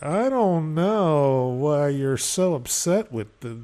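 slow, loud mock innocence; imitated infuriating attitude. Person answering someone yelling, put-on innocence. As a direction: draw it out as if baffled by the fuss. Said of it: "with that attitude".

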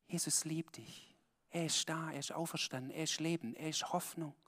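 hushed tender pastoral reassurance. Real, not acted. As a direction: speak it softly to one listener's heart. Soft, intimate blessing to listeners.

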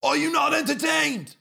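high, loud gladiator shout; seething shrieking spirit. Retold or imitated. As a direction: shriek it at the crowd. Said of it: "When he said".

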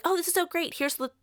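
high-pitched retold gushing; mock gushing customer. Client praises the stylist before complaining, mock gushing. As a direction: imitate a delighted customer, pitched well up.

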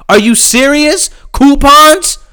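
high rising disbelief on Coupons?; incredulous. Real, not acted. Mocking the coupon complaint, incredulous high question. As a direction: spit out Coupons? like it's absurd.

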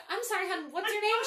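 high, loud mock disbelief; baffled question. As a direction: re-enact a puzzled double-check on a name. Retold baffled exchange with new coworker.

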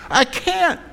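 very high pleading cry; distressed son. Acted. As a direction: protest to Dad in high-pitched distress. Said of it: "you're in distress".

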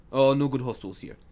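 put-on Russian driver; comic Russian host; Russian accent. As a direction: insist in a mock Russian accent. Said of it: "So he's Russian".

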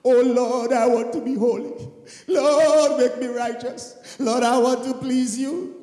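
earnest pleading prayer voice; a devoted believer crying to God; fervent prayer. Acted. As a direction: pray it out with longing.

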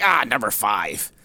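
mocking imitation. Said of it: "you're like".

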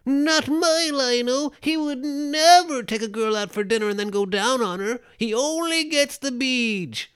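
high, slow, nasal-free mock fanboy whine; mock an outraged purist fan, stretching it out; high drawn-out fanboy parody; whiny fanboy. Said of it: "those guys that's like".

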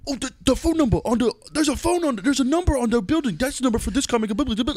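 high, breathless-with-excitement nerd babble; mock excited fan. Imitating Marvel fans spotting an Easter egg, mock-excited high babble. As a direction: gush in a high put-on voice, tripping over words.

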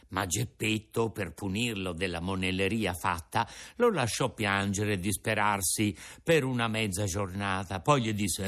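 ordinary delivery

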